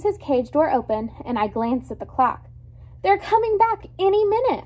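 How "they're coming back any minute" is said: higher, louder hurried warning; urgent warning. A narrator's reading. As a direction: warn a friend before they get caught. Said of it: "I try to warn him".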